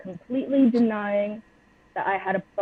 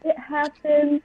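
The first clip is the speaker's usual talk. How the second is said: imitating sobbing; 7 semitones higher, 4 dB louder than usual. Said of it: "And I'm just sobbing".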